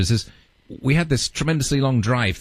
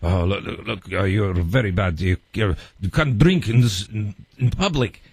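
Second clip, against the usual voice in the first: lightly voiced stern translator, a bit lower; a mild, scolding imitation of the translator; a hint of a stern official telling them off; 4 semitones lower than usual. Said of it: "he gets very stern".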